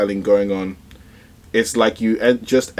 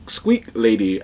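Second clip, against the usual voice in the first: usual speaking voice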